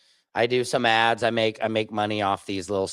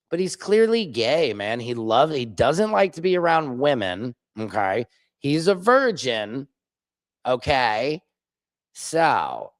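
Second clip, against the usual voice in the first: ordinary talk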